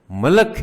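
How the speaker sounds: ملک said in two syllables, "ma-lik", high and loud; malik, chieftain. Shown as a demonstration. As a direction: say ملک with a after m and a short vowel before k. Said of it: "کسی قبیلے کا سردار ہے".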